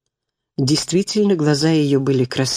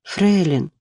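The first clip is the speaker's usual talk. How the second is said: timid; 3 semitones higher than usual. A narrator's reading. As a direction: say her name timidly. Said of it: "робко произнесла".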